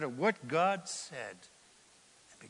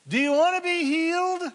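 much higher, loud ringing question; 12 semitones higher, 9 dB louder than usual. Acted. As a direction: cry it out to the crowd. Preaching climax, repeated question.

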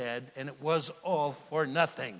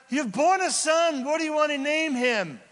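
much higher, louder bright question; high eager midwife; 12 semitones higher, 6 dB louder than usual. Acted. Midwife asking the dying mother the child's name, high and loud.

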